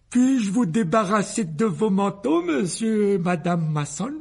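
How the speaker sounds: high, slow, over-polite host voice; a boy putting on company manners; Dudley's fawning voice. A narrator's reading. Dudley greeting guests, slow, high and fawning.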